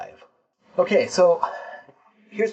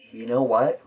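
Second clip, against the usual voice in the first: usual speaking voice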